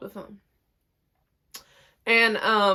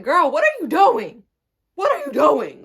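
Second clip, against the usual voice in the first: higher, louder retold scolding; exasperated mad; 6 semitones higher, 8 dB louder than usual. Retelling a row with the cousin, exasperated and mad. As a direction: scold her in loud disbelief. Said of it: "I'm just so mad".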